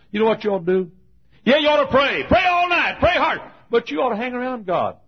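high, loud, shouted exhortation; preacher urging the congregation to pray, shouting; full-force preaching. Acted. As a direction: urge them to pray, shouting.

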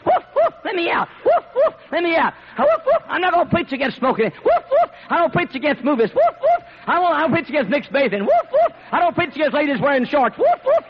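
yapping woofs jumping very high between plain-ish excuses; mocking Chihuahua preacher. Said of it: "some of you preachers".

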